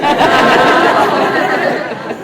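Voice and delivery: big, loud, high burst of laughter. Real, not acted. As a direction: crack up loudly. Laughing after joke about Seth.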